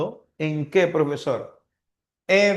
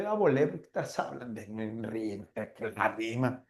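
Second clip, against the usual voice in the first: a hint of a lost student, quick and low under the breath; confused student; 7 semitones lower, 9 dB quieter, faster than usual. Teacher mimicking a lost student, quick and quiet. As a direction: mutter it fast like a pupil who's lost.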